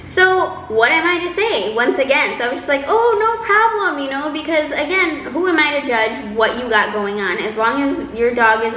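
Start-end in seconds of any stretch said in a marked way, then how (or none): 2.9-4.0 fake cheerful. Brushing off his apology.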